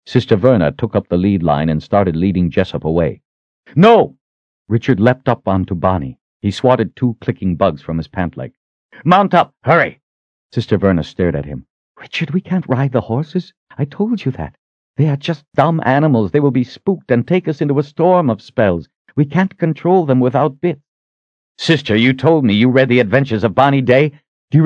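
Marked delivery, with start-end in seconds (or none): 3.7-4.2 high, loud refusal; sharp shout. A narrator's reading. Richard stopping the Sister leading horses away, sharp shout. 9.0-10.0 higher, louder, quick order; urgent command. A narrator's reading. Richard ordering the Sister onto her horse, urgent command. 12.0-20.8 higher-pitched woman's character voice, protesting; Sister Verna, objecting. A narrator's reading. Sister warning Richard against riding the horses, protesting.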